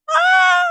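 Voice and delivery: high, loud delighted squeal; fangirl squeal. Real, not acted. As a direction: let out a happy shriek. Fangirl squeal over characters.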